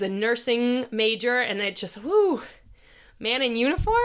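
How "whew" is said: high swooping "whew"; appreciative whew. Real, not acted. Podcaster recalling a man in scrubs, swooning whew. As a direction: let out a high, admiring whoo.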